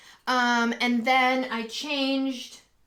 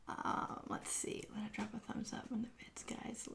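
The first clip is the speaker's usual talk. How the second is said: soft whispered aside; hushed whisper to the viewers; 18 dB quieter than usual. Real, not acted. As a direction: murmur it so nobody else hears.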